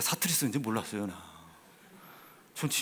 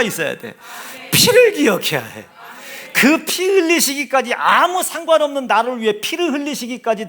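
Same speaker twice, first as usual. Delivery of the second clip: loud, high, urgent sermon delivery; impassioned pulpit exhortation, rising in force; full-force preaching; 7 semitones higher, 11 dB louder than usual. Acted. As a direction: drive each phrase home to the congregation.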